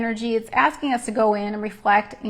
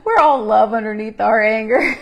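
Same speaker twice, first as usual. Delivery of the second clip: laughing through words; 7 dB louder than usual. Real, not acted. Laughing at angry emojis.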